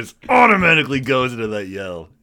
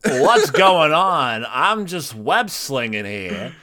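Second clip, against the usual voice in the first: slow, halting, oddly paced drawl; leisurely deadpan, words stretched out; Christopher Walken; 5 semitones higher, 7 dB louder, much slower than usual. Retold or imitated. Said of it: "Christopher Walken".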